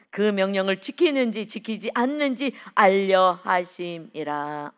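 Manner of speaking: loud, declamatory Bible reading; forceful pulpit recitation, stretched at the close; scripture reading. Acted. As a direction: proclaim the verse in solemn, weighty phrases. Reads scripture aloud to congregation.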